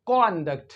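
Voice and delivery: कॉन्डक्ट with a rounded o, kon-dukt; the British कॉन्डक्ट, short rounded o; British conduct. Shown as a demonstration. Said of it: "और British English में कॉन्डक्ट".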